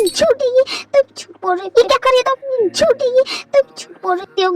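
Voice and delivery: high, shrill-pitched accusing voice; hysterical wife. Acted. Wife to her husband, high and accusing. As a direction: fling "liar" back at him in a high voice.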